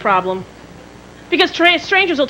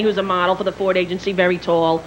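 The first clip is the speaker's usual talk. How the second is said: usual speaking voice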